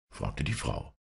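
usual speaking voice

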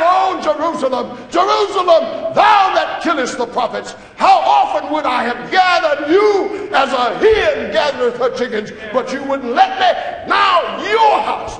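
high, loud lament over Jerusalem; crying out. Acted. Preacher voicing Jesus weeping over Jerusalem, high and loud. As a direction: cry it out as a grieving prophet. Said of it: "he cried out".

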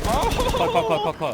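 excited shouting. Real, not acted. Celebrating kills in game.

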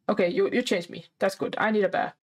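ordinary delivery

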